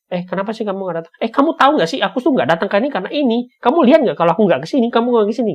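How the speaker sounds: high, loud, fast defensive outburst; snapping back. Retold or imitated. Friend snapping at a simple question, heated rant. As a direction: fire it back hot and quick.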